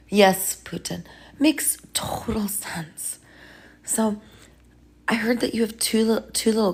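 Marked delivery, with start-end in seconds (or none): 1.4-3.2 slow hushed whisper. Acted. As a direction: soothe him under your breath. Healer soothing Putin.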